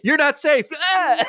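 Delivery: hammed-up frantic warning; mock urgent yell. Onita throwing the referee out, a mock yell. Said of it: "is just being like".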